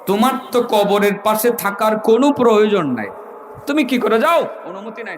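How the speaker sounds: high, calling-out rebuke; acted high-pitched challenge from an unseen voice; commanding dream voice. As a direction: call to a sleeper, then scold him. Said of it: "কে যেন তাকে বলতেছিল".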